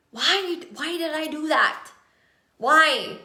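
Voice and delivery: mock regretful self-questioning. Coach voicing a client who regrets what she posted, mock regretful. Acting out regret after posting.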